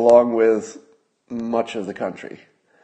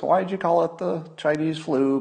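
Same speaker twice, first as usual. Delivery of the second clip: mock reporter; 6 semitones higher than usual. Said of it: "he was asked at the press conference".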